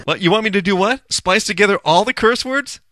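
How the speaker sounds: high-pitched, playful disbelief; put-on astonishment; mock incredulity. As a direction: question it as an outrageous request. Feigns disbelief at request to splice.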